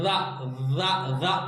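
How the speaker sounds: रा as a slow, low, repeated z-like syllable; articulation demonstration. Shown as a demonstration. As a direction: sound out रा slowly and low. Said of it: "लग्या तो कौन सा निकलता".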